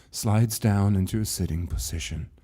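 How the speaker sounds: ordinary delivery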